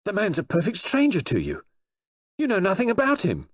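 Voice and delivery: high, strained, rising outrage; enraged protest. A narrator's reading. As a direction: Dick flares up, losing control. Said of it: "stammering with rage and anger".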